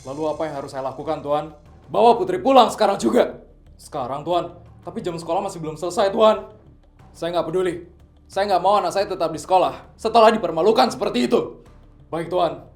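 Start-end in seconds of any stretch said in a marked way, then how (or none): 1.9-3.4 loud firm order; angry command. Acted. Father ordering bodyguard to fetch Putri, angry. 7.2-11.6 loud firm order; angry command. Acted. Father ordering bodyguard to fetch Putri, angry.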